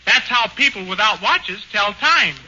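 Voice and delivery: ordinary talk, nothing marked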